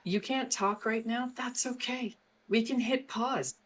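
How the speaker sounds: soothing parent. Shown as a demonstration. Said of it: "give the child the power of the pause".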